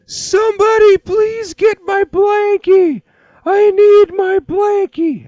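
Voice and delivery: put-on very high, loud, slow little-kid wail; sleepy kid calling out for his blankie; toddler crying for blankie. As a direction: wail it like a half-asleep toddler. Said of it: "Vince goes".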